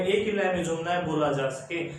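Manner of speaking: normal talking voice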